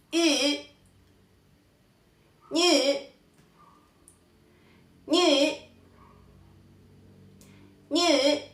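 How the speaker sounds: ñe stretched high with a rounded ü; third-tone demo. Shown as a demonstration. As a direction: slowly model Y, then ñe, vowel held. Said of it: "Tenemos el tercer tono". (ñe sostenido, en tercer tono)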